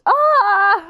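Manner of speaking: high, loud acted scream; mother's freak-out scream, hammed up; mock scream. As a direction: cry out in pretend horror. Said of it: "starts freaking out".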